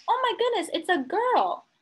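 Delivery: high astonished oh my goodness; surprised boy. Acted. Eddie sees a girl arrive, high and astonished. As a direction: blurt it out in shock. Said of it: "he says".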